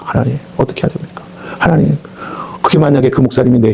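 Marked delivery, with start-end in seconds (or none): 0.0-2.0 soft, breathy plea to God under the breath; whispered prayer. Retold or imitated. (속삭이는 기도)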